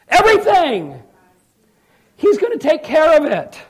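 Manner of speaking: high, loud preaching shout; triumphant proclamation. Real, not acted. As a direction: proclaim it with full conviction.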